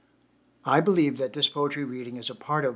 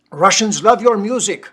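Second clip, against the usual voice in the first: put-on loud, higher, thick Russian accent; booming Russian fan, full of pride; 7 semitones higher, 15 dB louder than usual. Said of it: "thick Russian accent".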